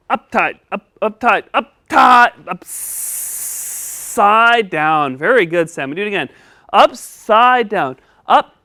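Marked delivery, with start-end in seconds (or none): 2.5-5.2 drawn-out, very slow phrase; very slow model. Shown as a demonstration. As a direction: stretch each syllable out. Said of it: "Try it again".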